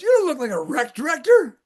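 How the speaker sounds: wife ribbing him about his outfit; wife's voice. Retold or imitated. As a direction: tease him like Carol would. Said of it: "She goes".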